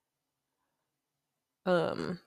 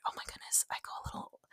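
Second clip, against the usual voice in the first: much softer whispered aside; 14 dB quieter, much faster than usual. Real, not acted. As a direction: whisper it, caught off guard. Suddenly remembers something.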